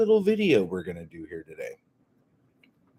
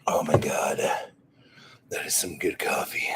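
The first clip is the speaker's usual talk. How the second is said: whispering; 4 semitones lower than usual. Real, not acted. Sipping coffee, whispered aside.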